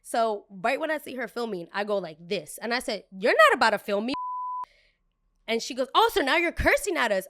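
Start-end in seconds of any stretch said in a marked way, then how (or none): 3.1-4.1 indignant, raised-voice refusal; loud warning. Retold or imitated. Confronts guest who is filming her. 6.0-7.2 high, loud imitation of an offended customer; mock indignation. Acts out the offended guest.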